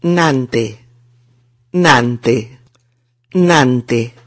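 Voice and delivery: 'nante' alone, evenly repeated with pauses; syllable drill. Shown as a demonstration. Teacher has learners repeat after him, syllable drill. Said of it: "para que lo pronunciéis bien".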